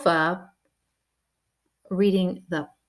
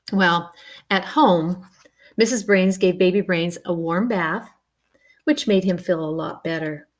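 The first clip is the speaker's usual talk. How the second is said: ordinary delivery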